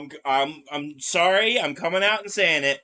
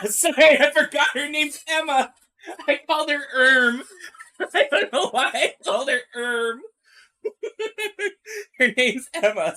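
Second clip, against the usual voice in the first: laughing; 6 semitones higher, 3 dB louder than usual. Real, not acted. Laughing at own mistake.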